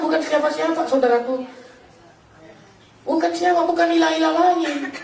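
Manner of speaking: high, sustained preacher's declaration; emphatic testimony held on a high pitch; preaching proclamation. Acted. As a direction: proclaim it to the congregation, pitched well up.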